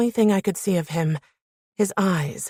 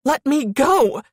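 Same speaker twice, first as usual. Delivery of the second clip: high, louder cry of panic; panicked yell; 8 semitones higher, 5 dB louder, slower than usual. A narrator's reading. Thrashing woman to man pinning her, panicked yell. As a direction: yell it as panic takes over. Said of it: "The panic seized me".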